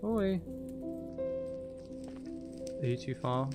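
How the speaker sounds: ordinary talk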